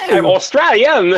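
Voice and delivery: high, stretched Australian boast; mock Australian accent. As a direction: draw it out in silly Australian. Joking in put-on Australian accent.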